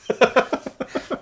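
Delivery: airy high rewind squeal; tape rewinding. Pretend. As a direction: imitate a cassette rewinding. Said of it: "That's the rewind noise".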